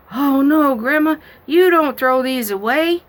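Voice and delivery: put-on alarmed little girl. Granddaughter stopping grandma from throwing cards away, high and alarmed. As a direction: protest like a shocked small child.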